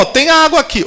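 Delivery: bem mais agudo, apontando a água; descoberta animada. Retold or imitated. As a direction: anuncie a água com surpresa feliz. Eunuco anuncia a água. (eunuco mostra a água a Felipe, animado)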